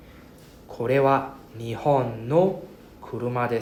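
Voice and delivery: これは日本の車で read very slowly, syllable by syllable; teacher reads exercise five to students, slowly; slow model reading. Shown as a demonstration. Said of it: "number five".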